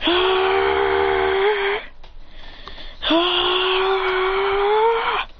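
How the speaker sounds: high straining cry; power-up cry. Acted. Going further beyond, high straining cry. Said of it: "He goes".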